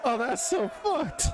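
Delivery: shocked outcry. Real, not acted. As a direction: burst out in real shock. Shocked at game event.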